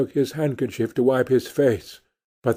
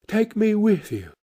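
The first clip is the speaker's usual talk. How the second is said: higher, pleading little cat; a lightly voiced cat, pitched up; 8 semitones higher than usual. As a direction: ask sweetly like a cat. The cat asks to come along.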